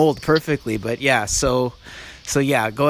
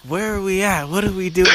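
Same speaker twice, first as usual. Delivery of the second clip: higher, lightly faked confused old man; mock confusion; 6 semitones higher than usual. Son retells Pop faking confusion at a pricey restaurant, higher mock bewilderment. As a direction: play lost to get out of the bill. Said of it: "starts acting confused".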